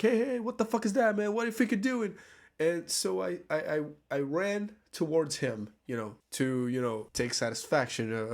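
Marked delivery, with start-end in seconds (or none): 0.0-2.1 mock angry yell. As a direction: yell at him to stand still, hammed-up. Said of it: "and I was like".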